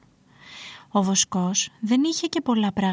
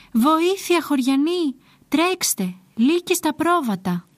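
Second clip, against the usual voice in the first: high, urgent storybook shout; calling for help; 6 semitones higher, 3 dB louder, slower than usual. A narrator's reading. Shepherd pranking villagers about wolves, shouting for help. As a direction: cry the false alarm out to the villagers. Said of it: "φωνάζοντας".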